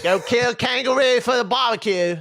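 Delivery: high-pitched hammed-up Australian; mock Australian accent. Co-host teasing the impression as Australian, mock Australian accent. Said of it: "He sounds Australian then".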